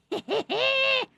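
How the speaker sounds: quick high-pitched giggle. Real, not acted. Amused laugh on her own.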